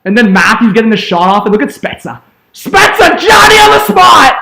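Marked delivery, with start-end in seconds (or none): 2.5-4.3 hyped call. Real, not acted. Replay hype.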